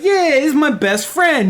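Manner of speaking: high, slow, cheerful pup voice; a beaming little dog bragging; happy doggy. Retold or imitated. The doggy introducing the owlbear cub at camp, high and glad. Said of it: "The doggy's like".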